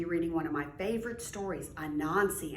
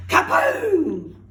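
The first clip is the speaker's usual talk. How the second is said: booming sound-effect word; loud kaboom; 11 semitones higher, 14 dB louder than usual. Acted. Narrator booming the rock's magic blast, loud. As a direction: blast it out like an explosion. Magic rock knocks Anansi down.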